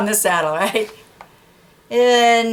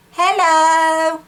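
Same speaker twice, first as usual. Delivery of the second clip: chirpy bird; 9 semitones higher, 4 dB louder than usual. Acted. Bird greeting.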